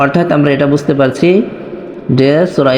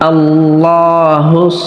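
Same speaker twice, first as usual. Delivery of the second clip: slow melodic recitation; recited slowly; much slower than usual. Shown as a demonstration. Teacher modelling the verse for students, slow chant. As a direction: draw out قل هو الله like tilawa.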